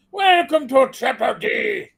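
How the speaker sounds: pirate voice. Shown as a demonstration. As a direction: bellow it like a ship's captain. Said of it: "I gotta do it with my pirate voice".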